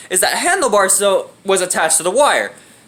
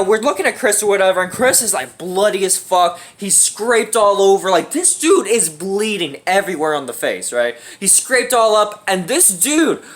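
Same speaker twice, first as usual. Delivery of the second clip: ordinary talk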